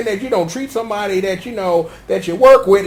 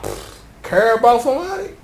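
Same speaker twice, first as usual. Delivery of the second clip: usual speaking voice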